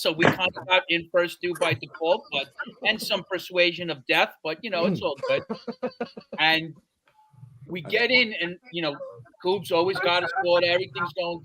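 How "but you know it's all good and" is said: ordinary delivery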